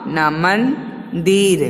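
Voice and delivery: ना then मंदिर very slowly; slow blending demo. Shown as a demonstration. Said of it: "मन, मा".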